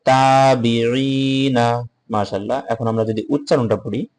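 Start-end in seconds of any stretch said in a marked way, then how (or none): 0.1-1.9 তাবিঈনা read slowly with the final না voiced; careful spelled-out reading; spelled reading with vowel. Shown as a demonstration. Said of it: "তা আলিফ জবর তা".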